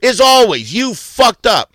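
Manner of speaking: furious yelled accusation; angry berating. Real, not acted. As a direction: lay into them, leaning on each word. Berating co-host in argument.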